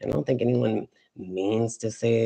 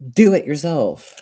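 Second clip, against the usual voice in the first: loud, forceful push on every word; emphatic; 4 semitones higher, 7 dB louder than usual. Real, not acted. Telling listeners to stop begging, emphatic. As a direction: punch out "do it yourself". Tells viewers not to beg.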